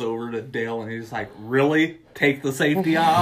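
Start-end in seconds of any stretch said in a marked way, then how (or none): 1.4-3.2 louder, higher incredulous question; retold exasperation. Tucker blaming Dale under gunfire, retold exasperation. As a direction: throw it back at him, loud and disbelieving.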